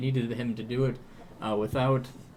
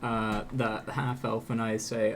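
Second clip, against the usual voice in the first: normal talking voice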